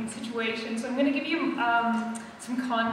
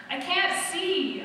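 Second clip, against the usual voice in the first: slow, higher cry of panic; mild scream; 6 semitones higher, much slower than usual. A narrator's reading. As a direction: cry out blindly, dragged slow. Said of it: "I scream".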